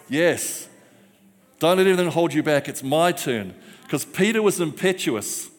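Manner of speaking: normal talking voice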